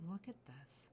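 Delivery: hushed, breathy awe over the jade; whisper. Real, not acted. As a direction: whisper it like a secret treasure. Admiring jade close to mic.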